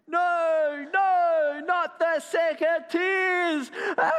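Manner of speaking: high, loud, drawn-out wail; hammed-up panicked shout ending in a scream; dramatic cry. A narrator's reading. Basil pleads with the gardener, crying out. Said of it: "cried Basil".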